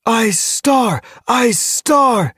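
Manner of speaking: high, loud chant of a name; cheering. A narrator's reading. Starry cats acclaim their new leader, cheering. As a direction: cheer it out like a jubilant crowd. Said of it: "as they began to cheer".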